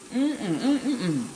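mm-mm hummed while chewing; closed-mouth hum. Real, not acted.

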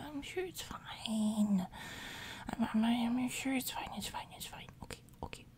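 ordinary delivery

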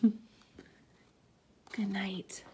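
breathy whisper. Shown as a demonstration. Storyteller ending bedtime story, whispered good night. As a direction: say goodnight in a hush. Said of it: "bedtime goodbye".

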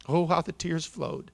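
ordinary delivery, nothing marked

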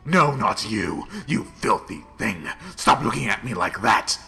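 loud scolding snap; angry rebuke. Acted. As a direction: snap at the filthy thing. Snapping at a thing.

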